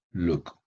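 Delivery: "look" like Luke; "look" with long oo. Shown as a demonstration. Teacher repeating the form to avoid, "look" as Luke. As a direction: make the oo in "look" tense. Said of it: "no digo look".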